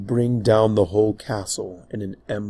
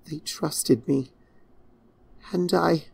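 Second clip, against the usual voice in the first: grieving princess; 7 semitones higher than usual. Acted. Princess breaking off.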